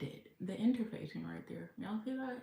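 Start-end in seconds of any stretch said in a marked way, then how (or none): none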